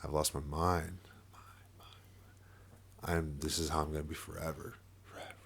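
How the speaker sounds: faint unvoiced whisper in the head; haunted whispered loop; echoing whisper. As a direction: breathe it like an inner echo. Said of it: "it would echo in my head".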